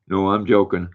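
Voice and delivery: usual speaking voice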